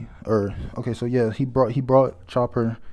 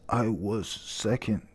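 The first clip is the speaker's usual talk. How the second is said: a hint of a wounded old pirate; quiet gruff correction; 7 dB quieter, slower than usual. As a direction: say it slowly and softly like a proud old man setting the record straight. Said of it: "he says, he says".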